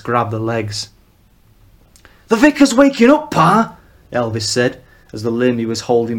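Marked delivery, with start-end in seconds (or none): 2.3-3.8 loud, much higher urgent call; alarmed call. Acted. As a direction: son warns father, alarmed shout. Son calls warning to father.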